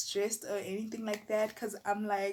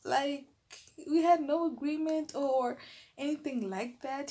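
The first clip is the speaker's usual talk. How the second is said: mild indignant thought; indignant inner voice; 6 semitones higher than usual. Retold or imitated. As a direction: argue it in your head. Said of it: "In my mind, I'm like".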